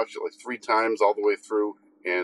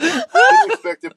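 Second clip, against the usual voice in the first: excited burst; 8 dB louder, slower than usual. Real, not acted. Delighted reaction to twin twist.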